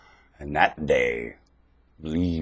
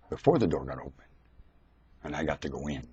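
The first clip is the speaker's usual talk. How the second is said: quiet retelling; 2 semitones lower, 7 dB quieter, faster than usual. Shown as a demonstration. Preacher restarting his story for the congregation, saying it quietly. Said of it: "I'll start where I started".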